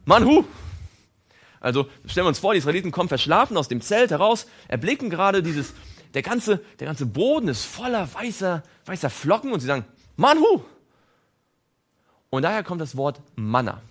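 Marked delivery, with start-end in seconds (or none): 0.1-0.4 Manhu said loud and high in Hebrew; proclaimed Hebrew Manhu; Hebrew word. Shown as a demonstration. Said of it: "heißt auf Hebräisch". 10.2-10.6 high, loud amazed shout of Manhu; astonished cry. Retold or imitated. Israelites seeing the flakes.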